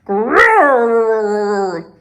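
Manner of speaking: rolling growl; bear growl. A narrator's reading. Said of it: "bear growls".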